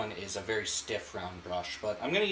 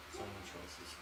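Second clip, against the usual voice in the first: softer, murmured to herself; quiet musing echo; 4 semitones lower, 15 dB quieter than usual. Real, not acted. Painter musing to her viewers, a soft echo. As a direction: drop the voice and muse it over.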